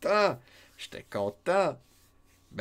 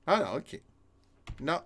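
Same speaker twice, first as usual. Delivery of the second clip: ordinary talk, nothing marked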